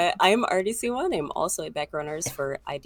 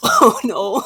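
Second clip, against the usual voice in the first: high, loud comic "oh no"; hammed-up cry of dismay; mock dismay; 4 semitones higher, 12 dB louder than usual. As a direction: wail it in exaggerated alarm. Panel's tech trouble, mock dismay.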